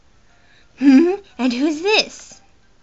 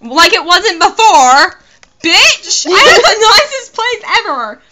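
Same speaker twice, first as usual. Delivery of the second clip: louder, higher, hammed-up indignation; mock outrage; 7 semitones higher, 12 dB louder than usual. Player retorting to the game's insult, loud mock outrage. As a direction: snap back at the game, half laughing.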